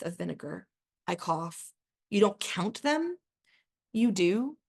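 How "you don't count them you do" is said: higher, drawn-out disbelieving question; incredulous question. A narrator's reading. Narrator to her date about counting timelines, incredulous. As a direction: ask it in mild disbelief, pitch lifting.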